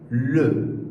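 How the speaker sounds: le with a rounded French schwa; French article le demo. Shown as a demonstration. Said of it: "palavra se pronuncia".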